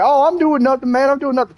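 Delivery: high-pitched, nervous denial; high evasive junkie voice. Retold or imitated. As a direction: play it innocent and jittery, voice up high. Craig voiced denying to officer.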